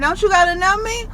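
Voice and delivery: alarmed question. Retold or imitated. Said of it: "I'm like".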